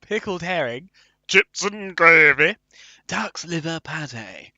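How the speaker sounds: put-on slow, drawn-out northern accent. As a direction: stretch each dish out in Northern English. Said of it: "written in a northern accent".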